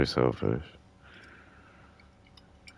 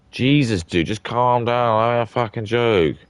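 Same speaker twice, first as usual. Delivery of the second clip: louder, higher mock exasperation; exasperated outburst; 8 semitones higher, 7 dB louder than usual. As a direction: throw up your hands and tell him to calm down. Exasperated at the immigrant.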